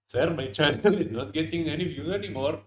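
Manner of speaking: high-pitched put-on Indian accent; pleading in an exaggerated Indian accent. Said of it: "I have to do this in an Indian accent".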